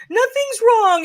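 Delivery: put-on high, protesting young-girl voice; acted crying child. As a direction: act out an upset girl insisting through tears. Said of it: "you know, and I'm crying".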